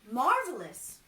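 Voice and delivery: loud delighted cry; cried out. Acted. Carpenter delighted by the prophecy, loud glad cry. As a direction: shout it with joy. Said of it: "cried the carpenter".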